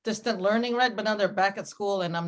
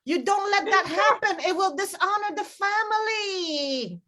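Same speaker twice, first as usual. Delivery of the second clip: high, dramatic mother's wail; mom's warning; 10 semitones higher, slower than usual. Retold or imitated. Mom warning her daughter about family honor, high and melodramatic. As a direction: forbid it in a high, stretched-out mock scolding. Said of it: "this is how my mom explained it".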